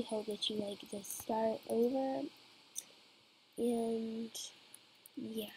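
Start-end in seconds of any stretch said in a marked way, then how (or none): none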